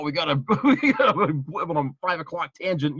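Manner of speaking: laughing through a mock Geordi line; amused send-up of an old-slang line. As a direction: poke fun at the slangy line, chuckling. Mocking Geordi's odd slang.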